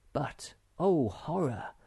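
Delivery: plain reading voice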